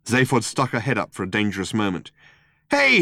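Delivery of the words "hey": high, loud shouted hail; calling out. A narrator's reading. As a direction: shout out to get their attention. Calls out to the shooters.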